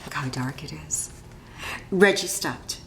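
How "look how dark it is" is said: scared hush. Acted. Scared boy in the dark.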